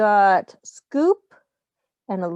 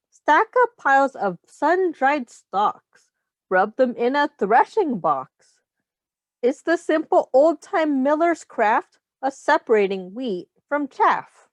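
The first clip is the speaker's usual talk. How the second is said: put-on reading to children; 3 semitones higher than usual. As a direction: read the rhyme aloud to the class. Reads picture book to children.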